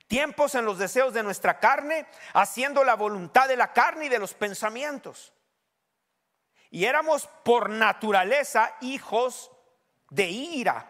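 forceful preacher reading aloud; emphatic preaching. Real, not acted. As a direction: proclaim the verse to the congregation.

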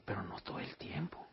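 whispered aside to himself; hushed inner voice; whispered thought. Retold or imitated. Thinking to himself.

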